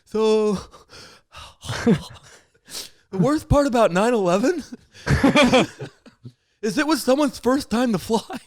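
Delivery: giggling hard through the punchline, voice up high; can barely get the words out for laughing; laughing through the joke. Retold or imitated. Comic telling his bombed joke, laughing all through it. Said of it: "I'm like".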